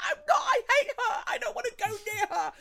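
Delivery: put-on a kid yelling back at mum, laughing; childish protest shout. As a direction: shout it, high and loud, laughing through it. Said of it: "And I'm like".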